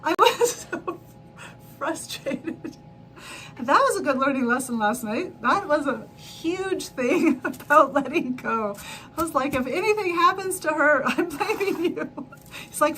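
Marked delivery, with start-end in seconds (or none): 0.0-2.8 laughing. Real, not acted. Telling viewers about her frustrating night, laughing. 9.5-12.3 higher, louder, laughing on blaming; half-joking warning with a laugh; laughing threat. Retold or imitated. Telling her dad she'll blame him, laughing.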